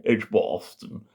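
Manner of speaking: Edgbaston said slowly with a long posh "ar" in the middle; posh pronunciation of Edgbaston. Shown as a demonstration. Naming the doctor's home as they say it, Edgbaston in mock-posh long vowels. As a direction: draw out the middle of Edgbaston as "Edge-BAR-ston", mock-posh. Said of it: "as they say".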